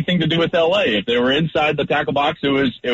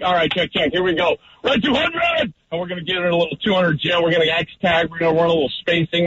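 higher, quick, pumped-up play call at the line; calling the audible; 4 semitones higher than usual. Shown as a demonstration. Quarterback changing the play at the line, higher and quick. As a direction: rattle off the check like you're under center. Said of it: "and you're like".

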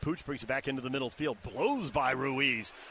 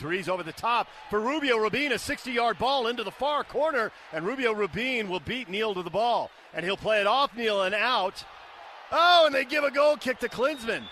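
rising excitement, higher and louder; excited play-by-play; 6 semitones higher, 5 dB louder than usual. Real, not acted. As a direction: build the call up to a big peak. Commentator calls a long attacking ball.

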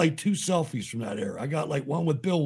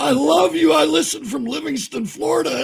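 high, loud gushing fan voice; 11 semitones higher, 6 dB louder than usual. Retold or imitated. A starstruck listener greeting the hosts, high and gushing.

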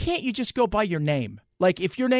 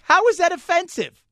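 indignant retort; 12 semitones higher, 5 dB louder than usual. Retold or imitated. Host answering someone offended, loud incredulous retort. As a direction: fire the question back in disbelief. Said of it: "I'm like".

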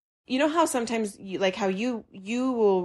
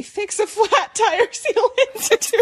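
much higher, laughing through words; 13 semitones higher, 5 dB louder than usual. Real, not acted. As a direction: crack up mid-sentence. Laughing at absurd detail.

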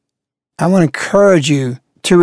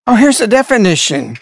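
usual speaking voice

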